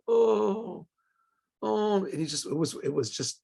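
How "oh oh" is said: mournful sigh. Retold or imitated. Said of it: "he starts to weep".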